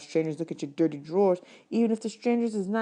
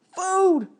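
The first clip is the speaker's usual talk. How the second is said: excited shout; 14 semitones higher, 6 dB louder than usual. Acted. Bud waking to food.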